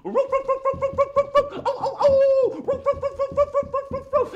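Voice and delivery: high, loud yapping with a howl; excited dog barking. Pretend. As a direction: bark wildly like an overexcited dog. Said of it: "He gets really excited sometimes".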